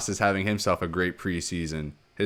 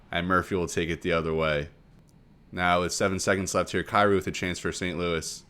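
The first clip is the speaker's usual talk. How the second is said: normal talking voice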